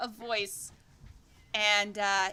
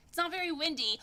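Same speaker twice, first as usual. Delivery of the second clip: ordinary talk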